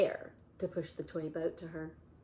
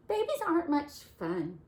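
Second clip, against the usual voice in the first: grumpy Bear; 9 semitones higher, 6 dB louder than usual. Acted. Bear complaining in story.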